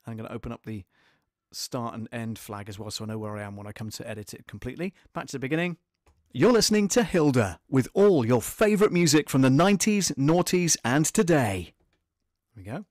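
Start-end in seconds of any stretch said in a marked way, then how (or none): 6.3-11.7 loud, upbeat station ident; radio announcer. Acted. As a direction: punch it like a radio jingle.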